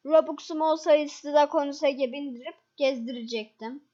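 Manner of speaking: ordinary talk, nothing marked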